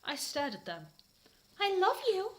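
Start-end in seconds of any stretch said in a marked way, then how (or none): 1.6-2.3 high swoon; swooning confession. Acted. Ebony confessing to Draco, high swoon. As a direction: breathe it to your love. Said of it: "I said to Draco".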